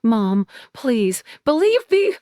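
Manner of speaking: desperate plea climbing very high; begging. A narrator's reading. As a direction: implore her, voice rising sharply at the end. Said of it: "I'm begging now".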